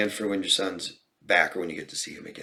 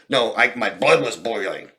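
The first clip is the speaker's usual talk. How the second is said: emphatic, louder example of fury; demonstrated anger; 3 semitones higher, 6 dB louder than usual. Shown as a demonstration. Therapist showing a client how to describe anger, emphatic mock fury. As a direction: act out vivid anger for illustration. Models a vivid feeling description.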